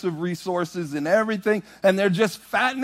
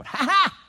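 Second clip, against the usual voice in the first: quiet high "ha ha" from the back; soft mocking laugh; 10 semitones higher than usual. Kids in the back mocking the lady. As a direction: copy her under your breath. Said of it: "We go".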